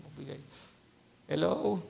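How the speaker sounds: ordinary delivery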